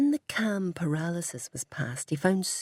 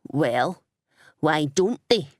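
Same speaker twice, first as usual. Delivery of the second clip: louder, sharp hissed retort; hissing challenge; 5 dB louder, slower than usual. Acted. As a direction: jab the question at Ian. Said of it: "The shopkeeper hissed at Ian".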